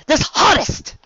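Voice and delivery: loud, high complaint; exasperated outburst. A narrator's reading. Rarity kicking under the harness, exasperated. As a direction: burst out in frustration.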